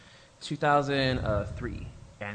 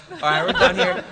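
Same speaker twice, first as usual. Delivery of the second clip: laughing brush-off; 4 semitones higher, 12 dB louder than usual. Retold or imitated. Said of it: "And I was like".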